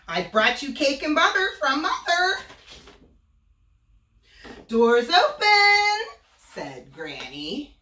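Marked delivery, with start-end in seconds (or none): 0.1-2.4 put-on high, loud squeak faking a little girl; squeaky fake voice. Wolf squeaking to Granny pretending to be Little Red. 4.7-6.2 put-on high, loud, slow old woman; drawn-out granny call. Granny calling the wolf inside.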